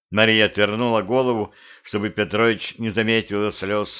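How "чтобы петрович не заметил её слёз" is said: ordinary delivery, nothing marked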